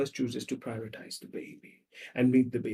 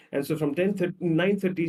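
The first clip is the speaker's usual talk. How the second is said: ordinary talk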